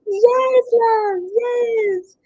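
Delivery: high laughing yes. Real, not acted. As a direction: laugh through the word. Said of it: "you sound like a dying cat".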